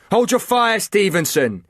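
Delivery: high, loud yelled command; yelled order. A narrator's reading. Liam yelling at Chris to stop, loud yell. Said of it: "yelled Liam".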